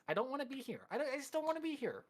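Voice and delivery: high, whining moan; whiny complaint. Acted. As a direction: whine like you want to go home. Player whining about the game night.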